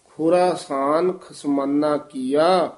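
ਖੁਰਾਸਾਨ stretched, slightly split between ਖੁਰਾ and ਸਾਨ; drawn-out syllables. Shown as a demonstration. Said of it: "dash ਦੇ ਕੇ ਲਮਕਾ ਕੇ". (ਖੁਰਾਸਾਨ ਨੂੰ ਖੁਰਾ-ਸਾਨ ਲਮਕਾ ਕੇ ਬੋਲੋ)

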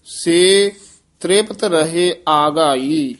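ਆਘਾਈ said slowly with a long open first aa; ਆਘਾਈ with both long aa sounds clearly spoken; long first vowel. Shown as a demonstration. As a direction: stretch the first syllable of ਆਘਾਈ. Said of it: "ਆਘਾਈ ਕੰਨਾਂ ਲਾ ਕੇ".

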